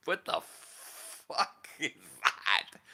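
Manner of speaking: slow, drawn-out laughing disbelief; incredulous, laughing and slow. Retold or imitated. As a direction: stretch it out through a laugh. Retold reaction, drawn out, laughing.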